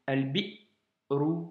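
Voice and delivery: al-bi'ru stretched out, a clean glottal catch before ru; Arabic reading demo; slow reading model. Shown as a demonstration. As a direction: hold the break in al-bi'ru, then release.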